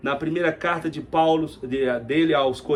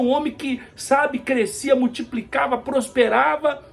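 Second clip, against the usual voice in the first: high-pitched preacher's exclamations, swooping on key words; worked-up, higher-pitched sermon delivery; impassioned preaching; 10 semitones higher, 5 dB louder than usual. Real, not acted. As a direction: preach it with mounting astonishment.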